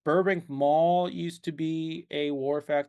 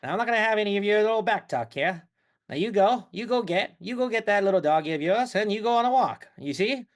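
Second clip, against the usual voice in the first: put-on higher, bossy old-movie Mid-Atlantic patter; Atlantic talkie accent; 4 semitones higher than usual. Shown as a demonstration. Ordering missy to walk the dog, in fifties talkie accent. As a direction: lay down the law in talkie accent. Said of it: "I could do talkie all episode".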